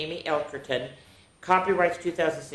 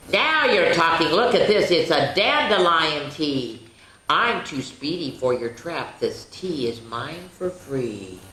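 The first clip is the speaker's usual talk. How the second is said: cheeky leprechaun rhyme; slower than usual. Acted. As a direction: read the leprechaun's boasts to the kids, playfully. Reads leprechaun picture book aloud.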